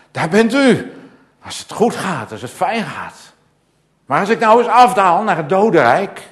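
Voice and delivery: high, loud, rising cry to God; impassioned psalm prayer. Acted.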